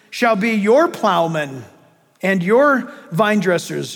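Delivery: ordinary delivery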